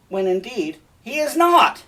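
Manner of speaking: high, loud burst on "he is not"; emphatic exclamation. Real, not acted. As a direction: build to a high, emphatic protest. Exclaims the truth about Cassio.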